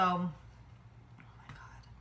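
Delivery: breathless whisper; whispered awe. Real, not acted. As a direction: whisper it stunned. Overwhelmed by flan.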